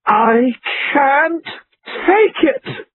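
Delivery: climbing, high-pitched yell; rising hysterical cry, pitch soaring. A narrator's reading. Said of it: "Laura yelled".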